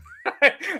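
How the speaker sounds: high bursting laugh; real laugh. Real, not acted. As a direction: break into a laugh. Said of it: "laughing at own remark".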